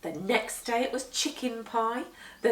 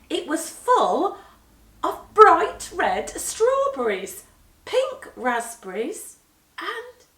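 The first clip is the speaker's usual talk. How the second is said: building excitement; 7 semitones higher, 8 dB louder, slower than usual. A narrator's reading. Building reveal of fruit.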